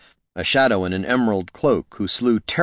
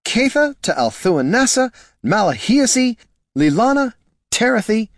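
high, loud fussy elf calling a long name; a flustered agent reeling off her full name; excitable elf agent; 4 dB louder than usual. Acted. Agent Leary scolding Catha by full name, high and loud.

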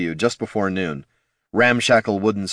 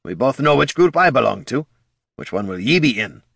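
gruff dwarf voice; 4 semitones higher than usual. Acted. Dwarf Gorm speaks.

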